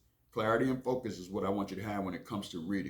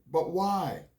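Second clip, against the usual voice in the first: put-on higher, louder pleading child; whiny kid voice; 10 semitones higher, 6 dB louder than usual. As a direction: beg like a little boy wanting a cookie. Child Billy begs mom.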